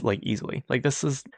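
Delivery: ordinary talk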